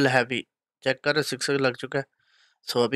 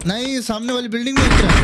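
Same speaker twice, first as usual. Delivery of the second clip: excited callout; 10 semitones higher, 6 dB louder than usual. Real, not acted. Enemy landing in next building.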